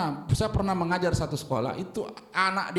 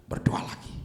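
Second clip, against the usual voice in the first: whisper. Real, not acted. Preacher urging prayer again.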